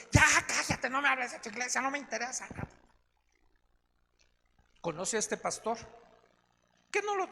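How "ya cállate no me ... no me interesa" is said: exasperated retort. Retold or imitated. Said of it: "él se desesperó y dice".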